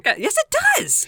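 high, indignant shout. Real, not acted. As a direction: shout it back at him. Shouted retort.

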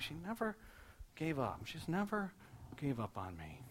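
hushed retelling, pausing before each GAVE; struck by realisation. Retold or imitated. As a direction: break it into pieces, as if it is sinking in. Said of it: "like it struck him as he said it".

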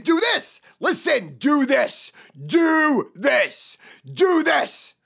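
high, loud barked do this; shouted command. Shown as a demonstration. Salesman demonstrating the command to a customer. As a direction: order them what to do. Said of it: "What's the next action?".

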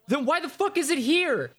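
high, exasperated rant; fed-up gamer's mock outrage; incredulous outrage. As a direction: demand an answer from the developers. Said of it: "And you're like".

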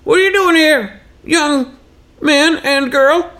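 very high, put-on old-timer voice; old Texan man. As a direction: throw the question back as a high-voiced Texan geezer. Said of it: "he grew up in Texas".

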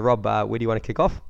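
normal talking voice

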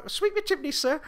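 a hammed-up high Cockney-ish British accent; ridiculous British accent. Mocking the dubbed truck driver in a silly British accent. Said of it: "this ridiculous British accent".

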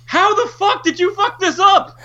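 high, slow, laughing outraged cry; incredulous outrage. Retold or imitated. Fan reacting to the trailer with laughing, high-pitched outrage. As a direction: wail at the ruined trailer, half laughing.

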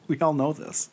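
hushed, breath-only remark; conspiratorial whisper; whispered aside. Acted. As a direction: whisper it as an obvious fact. Muttered aside after joke.

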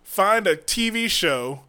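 ordinary delivery

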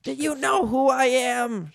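high, drawn-out outraged yell; comic shouting in a high put-on voice; Danny DeVito. A narrator's reading. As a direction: play an outraged little man, stretching words. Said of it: "shouted the man".

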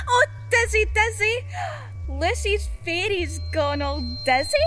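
dizzy high giggling; giggly drunk. Acted. Drunk fairy giggling that she's gone dizzy. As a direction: giggle through dizziness.

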